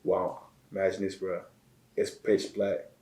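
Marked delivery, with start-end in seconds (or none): none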